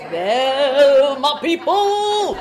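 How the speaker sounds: loud, high put-on voice; mock proud preacher. Preacher hamming up a proud old preacher, loud and high.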